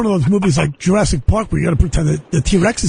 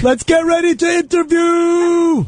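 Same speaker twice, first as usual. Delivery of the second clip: boxing announcer; 11 semitones higher, slower than usual. Pretend.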